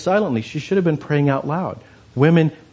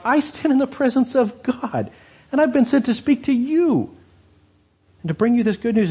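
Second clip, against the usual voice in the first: angel Gabriel; 9 semitones higher than usual. Retold or imitated. Angel Gabriel rebukes Zechariah.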